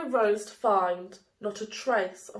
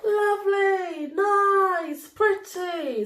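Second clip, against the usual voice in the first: high, loud and sugary; high gushing praise for the day; sweet gushing; 10 semitones higher, 6 dB louder, slower than usual. Acted. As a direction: coo each word like praise. Sweet names given to Day.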